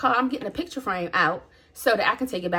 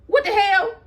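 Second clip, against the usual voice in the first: startled; 9 semitones higher, 4 dB louder than usual. Retold or imitated. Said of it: "she heard me say".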